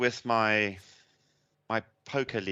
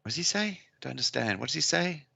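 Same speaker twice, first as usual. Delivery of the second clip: higher-pitched mock-puzzled listener; lightly acted incomprehension, pitched up; imitated bafflement; 6 semitones higher than usual. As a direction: imitate a baffled man asking his neighbour. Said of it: "turn to the guy next to him and goes".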